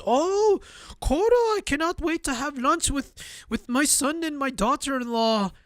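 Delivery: high-pitched, exaggerated Filipino accent; mock Filipino accent, high and sing-song; terrible Filipino accent. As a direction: ham up a bad Filipino accent. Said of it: "that's a very terrible Filipino accent".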